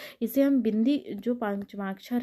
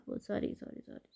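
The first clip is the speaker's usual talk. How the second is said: muttered apology; 12 dB quieter than usual. Real, not acted.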